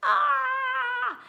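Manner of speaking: high, loud shriek; yelp of pain. Acted. Child shrieking in the dentist chair, loud and high. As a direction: scream as the prickle comes out. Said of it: "I shouted".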